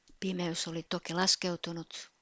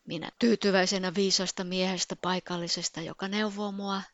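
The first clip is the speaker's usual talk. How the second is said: normal talking voice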